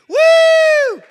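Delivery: loud, very high woo; piercing crowd-rousing cheer; cheering whoop. Real, not acted. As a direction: whoop for the act. Host cheers the comic.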